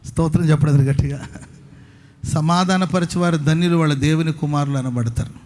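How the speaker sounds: ordinary talk, nothing marked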